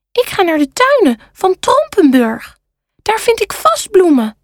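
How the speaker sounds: high-pitched, hopeful panda voice; high storybook panda with a plan; eager panda. A narrator's reading. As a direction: play the keen little panda. Maas the panda speaks.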